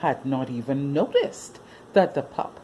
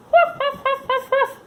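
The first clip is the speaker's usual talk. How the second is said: puppy yelp; 6 dB louder than usual. Acted. Puppy confused, playful sound.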